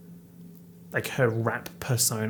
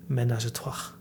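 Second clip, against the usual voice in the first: French accent. Shown as a demonstration. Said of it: "Get into the French accent".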